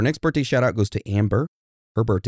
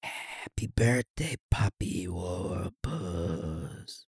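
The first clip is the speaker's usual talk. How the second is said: soft, slow breathy whisper-sing; sweet nothings; 2 semitones lower, 9 dB quieter, much slower than usual. Pretend. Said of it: "whispering sweet nothings into my ear holes".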